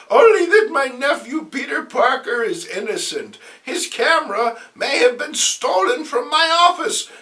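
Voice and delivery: put-on high, loud, fluttery old aunt voice pleading with laughter; a frantic sweet old aunt defending her nephew; flustered Aunt May. Aunt May pleading with reporters, high and frantic.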